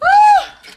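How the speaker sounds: very high-pitched gleeful "woo"; loud high whoop. Real, not acted. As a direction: let out a piercing whoop after a laugh. Whoops after laughing at own roast.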